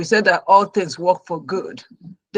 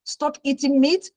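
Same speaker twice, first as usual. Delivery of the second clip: near tears; 5 semitones higher than usual. Retold or imitated. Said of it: "I almost cried".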